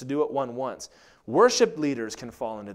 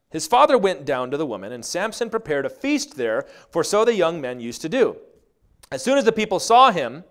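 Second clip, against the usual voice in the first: scripture reading; 5 semitones higher, 9 dB louder than usual. Acted. Reads scripture.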